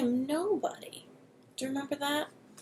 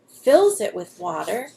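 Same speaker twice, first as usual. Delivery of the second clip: plain reading voice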